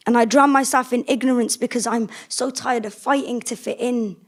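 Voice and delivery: usual speaking voice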